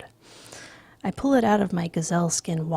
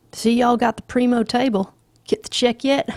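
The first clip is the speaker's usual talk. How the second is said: higher and louder, back-slapping Montgomery; hearty Southern big shot; 6 semitones higher, 7 dB louder than usual. Acted. Rich man greeting the fellas at the table, loud and hearty. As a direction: play the loud Southern glad-hander.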